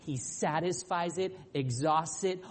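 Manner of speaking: ordinary talk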